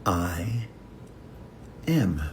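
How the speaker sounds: hushed, with a long pause between words; two separate words, softly spaced; slow, quiet affirmation. Shown as a demonstration. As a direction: say it slowly to yourself. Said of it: "slowly say".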